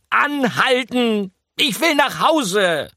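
squeaking. A narrator's reading. Mouse squeaks as the rats carry him away. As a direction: squeal like a panicked little mouse. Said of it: "quietschte Pikandou".

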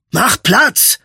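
high, angry command; angry scolding. A narrator's reading. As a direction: scold the person blocking you. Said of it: "schimpfte Royce".

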